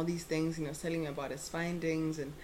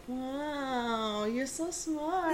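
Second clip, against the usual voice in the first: high, slow gushing with a laugh; gushing admiration; 7 semitones higher, much slower than usual. Retold or imitated. Gushing at the old professor's plant facts, slow and high. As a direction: fawn over him sweetly.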